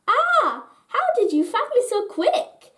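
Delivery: high, whiny complaint; high-pitched grumble at being found; complaining. A narrator's reading. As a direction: whine it like a sore loser. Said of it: "Tippy Taps complained".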